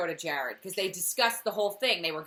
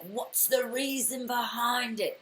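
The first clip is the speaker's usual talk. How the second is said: slow, higher-pitched attempt at Murtaugh's accent; badly faked accent; 5 semitones higher, 3 dB quieter, slower than usual. Podcaster imitating Murtaugh's question, in a badly faked accent. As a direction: put on a terrible drawn-out accent. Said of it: "That was the worst accent".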